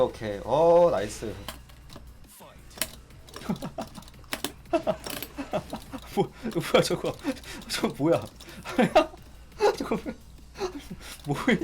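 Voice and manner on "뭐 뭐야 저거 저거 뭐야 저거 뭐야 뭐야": incredulous laughing. Real, not acted. Baffled by the opponent's move.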